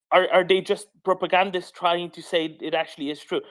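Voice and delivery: high, exasperated disbelief; incredulous. Real, not acted. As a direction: ask in stunned disbelief. Incredulous rant at propagandists.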